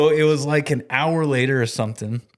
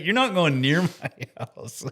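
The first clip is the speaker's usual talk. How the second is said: high, slow, amused objection; retold mock protest; 6 semitones higher than usual. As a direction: playfully lay down the law, voice raised in pitch. Retold laughing protest to colleague.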